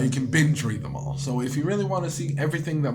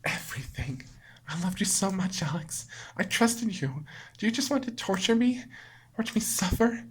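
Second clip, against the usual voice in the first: higher, soft, wounded; lightly voiced heartbroken girl; hurt Monika; 6 semitones higher, 4 dB quieter than usual. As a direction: plead through the hurt. Monika voice, accusing.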